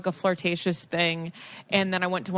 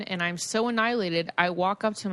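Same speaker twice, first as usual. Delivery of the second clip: ordinary delivery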